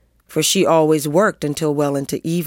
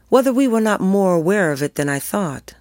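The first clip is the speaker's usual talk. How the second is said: usual speaking voice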